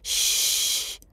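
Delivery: soft voiceless shushing; pleading hush. A narrator's reading. Nochash begging the shouting wizard to be quiet, pleading hush. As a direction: hush them softly and urgently. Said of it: "התחנן נוחש".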